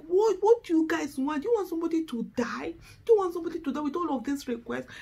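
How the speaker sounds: fast, exasperated rant; angry outburst. Retold or imitated. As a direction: fire off the questions in frustration. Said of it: "Sometimes I get angry. I'm like".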